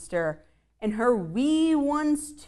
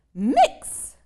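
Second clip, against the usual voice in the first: mummy mommy's call; 5 semitones higher, 9 dB louder than usual. Acted. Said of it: "called the mommy".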